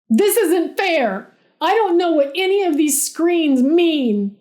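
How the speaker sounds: loud, high, frantic complaint; yelled. A narrator's reading. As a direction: yell it in a panic. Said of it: "he yelled".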